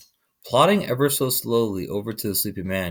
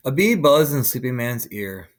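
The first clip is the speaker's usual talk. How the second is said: plain reading voice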